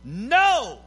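slow, building to a loud shout; preached scripture. Acted. Preacher quoting the Bible to the congregation, rising to a shout. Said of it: "And the Bible says".

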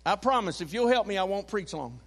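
usual speaking voice

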